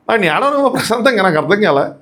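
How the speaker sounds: usual speaking voice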